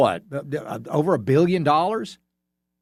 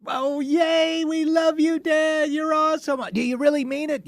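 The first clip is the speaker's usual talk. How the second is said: put-on much higher squeaky kid voice; mock five-year-old. Kids cheering dad's Disney promise, squeaky high child voice. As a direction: play a gushing little kid, way up high. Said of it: "that five-year-old mentality".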